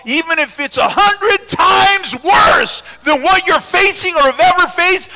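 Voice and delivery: building from firm to a shouting climax; preaching at full force. Acted. Preacher rallying the congregation at full shout.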